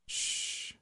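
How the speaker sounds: soft hissed shush. A narrator's reading. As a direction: hush him like a child. Teasing hush before stabbing.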